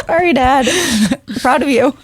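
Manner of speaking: high voice through a laugh; laughing mock apology. Telling friends what she said to dad, laughing mock apology. Acts out teasing reply to dad.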